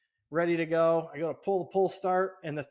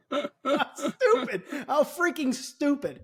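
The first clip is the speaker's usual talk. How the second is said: slow, higher, laughing disbelief; laughing scorn; 11 semitones higher, much slower than usual. Real, not acted. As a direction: laugh it off in disgust. Laughing at the store's policy.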